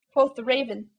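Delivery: ordinary delivery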